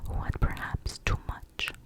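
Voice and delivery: ordinary delivery, nothing marked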